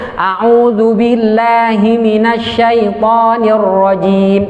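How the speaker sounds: slow, drawn-out chanted recitation; Quran recitation. Shown as a demonstration. Teacher reciting for students to repeat, slow chanted recitation. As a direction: intone it slowly for pupils to repeat. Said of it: "Ayo ikutin, nah".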